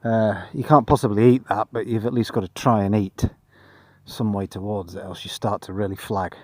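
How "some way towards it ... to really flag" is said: flat, tired monotone voice. Real, not acted. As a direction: drone it out, flat and weary. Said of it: "my monotone voice".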